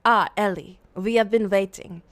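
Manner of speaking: put-on low, measured old master; old lord voice.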